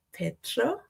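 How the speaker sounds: normal talking voice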